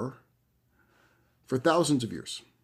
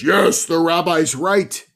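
loud, slow, raised proclamation; big booming voice; 4 semitones higher, 7 dB louder, slower than usual. Retold or imitated. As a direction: boom it out as the voice from above. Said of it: "this big booming voice says".

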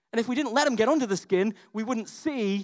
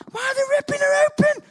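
much higher, louder, alarmed yell; freaking-out yell in the theatre; panicked shout; 9 dB louder than usual. Retold or imitated. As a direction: cry out in horror at the ripping. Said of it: "I'm freaking out".

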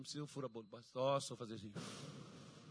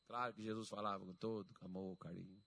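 low, soft, tender voice; loving tone; 6 semitones lower, 3 dB quieter than usual. Shown as a demonstration. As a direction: drop into gentle reassurance after the shouting. Contrasts loving Jesus with own shouting.

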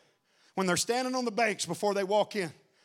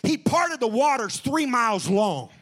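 preaching at full force; 6 semitones higher than usual. Acted. Preacher shouting to the church. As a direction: preach it out to the congregation.

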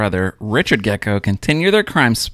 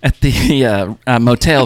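ordinary talk, nothing marked

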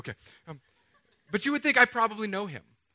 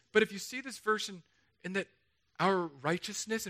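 ordinary delivery

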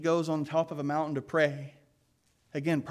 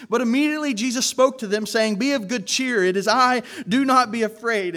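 reassuring call; 6 semitones higher, 7 dB louder than usual. Acted. Jesus calms frightened disciples.

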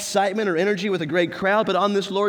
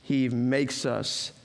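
slow, fading; 3 semitones lower, 3 dB quieter, slower than usual. Acted. Preacher slows down on rest.

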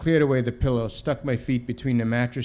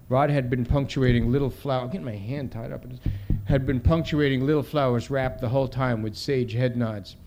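ordinary delivery

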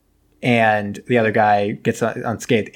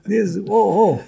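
high mock German accent; hammy German officer marvelling, pitched up; 9 semitones higher than usual. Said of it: "think about it in a German accent".

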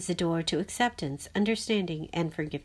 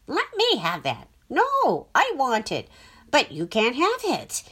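greedy inner voice; 5 semitones higher, 5 dB louder than usual. A narrator's reading. Mind's heated argument, grabbing voice.

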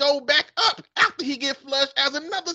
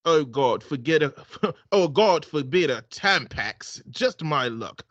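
put-on man's British accent; 9 semitones lower than usual. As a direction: read it like a smug English gentleman. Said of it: "man and a woman accent".

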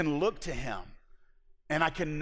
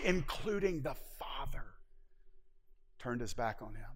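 hushed drop; 3 semitones lower, 8 dB quieter than usual. Acted. Sermon drops to hush.

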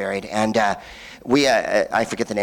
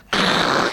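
voiceless rushing downpour noise; imitated rain noise. Comedian shows the rain outside, imitated rushing noise. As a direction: make a short whoosh of pouring rain.

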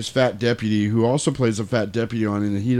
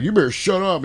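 higher, mock southern warning; southern yokel accent; 9 semitones higher than usual. Deputy warning the bar yokels, southern accent. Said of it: "I love doing these southern yokel accents".

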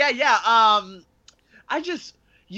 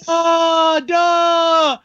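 high, loud, drawn-out mocking "duh"; exasperated, blurt it loud and long; retold angry outburst; 7 semitones higher, 7 dB louder than usual. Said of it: "I got so angry because".

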